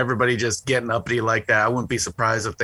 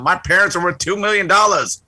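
mock yell; 7 semitones higher, 3 dB louder than usual. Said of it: "just yelling out".

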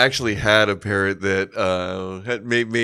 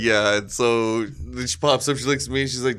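ordinary delivery

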